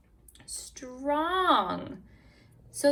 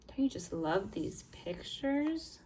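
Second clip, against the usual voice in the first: ordinary delivery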